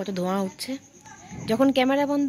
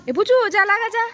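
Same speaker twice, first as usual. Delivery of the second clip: higher, louder shout across the lane; calling out; 10 semitones higher, 7 dB louder than usual. Real, not acted. Calling out to the boy.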